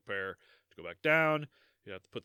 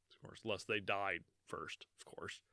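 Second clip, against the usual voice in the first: whisper; 5 semitones lower, 11 dB quieter than usual. Real, not acted. Aside whispered after dark joke.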